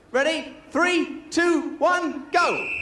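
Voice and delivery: rising, ringing countdown to start the heat; countdown call. Real, not acted. Host counting the blindfolded player in, excited call. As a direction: call the start like a race marshal.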